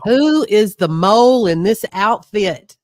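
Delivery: loud, demanding king's question; angry king. Acted. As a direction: bark it like a furious king.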